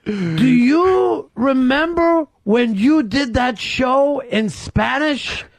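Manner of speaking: slow, high, drawn-out question; hammed-up, slow questioning of a recognised celebrity; acted dream attacker. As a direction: stretch out each word in a put-on voice.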